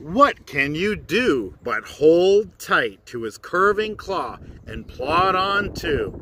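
usual speaking voice

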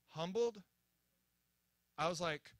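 ordinary delivery

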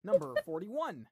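steady bingo caller call-out. Acted. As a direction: announce each number like a hall caller. Calls bingo numbers.

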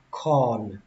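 corn with a long, stretched o before n; drawn-out O. Shown as a demonstration. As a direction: stretch the vowel of "corn", letting it fall. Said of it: "kéo âm O ra một tí".

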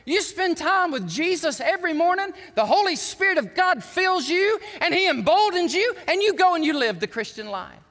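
loud, high, driving preacher delivery; punchy, emphatic pulpit proclamation; fired-up preaching. Real, not acted. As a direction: preach it out with rising force. Preaches at full force.

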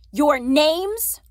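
loud, high, rising question; barked demand. A narrator's reading. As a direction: bark it like an interrogating officer. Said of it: "the officers barked".

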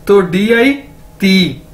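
ordinary talk, nothing marked

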